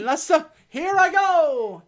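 loud, high, drawn-out announcement; melodramatic stalling. Retold or imitated. Alien threatening to follow his queen, melodramatic. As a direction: stretch it out, waiting to be stopped. Acting stalling before mock suicide.

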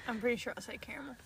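fast unvoiced whisper; whispered aside to friends. Real, not acted. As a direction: whisper it quickly, almost laughing. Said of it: "whispered, unvoiced".

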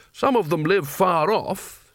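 tentative and drawn out; careful. A narrator's reading. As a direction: pick each word with caution. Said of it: "said Carding carefully".

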